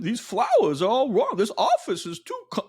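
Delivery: high-pitched celebrity impersonation that slips away; hammed-up, halting actor impression; Christopher Walken. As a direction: push a movie-star voice high, then falter and drag the last word. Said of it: "see, I lost it again".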